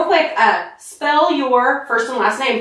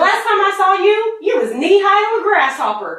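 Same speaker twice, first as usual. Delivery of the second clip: Southern accent; 7 semitones higher than usual. Shown as a demonstration.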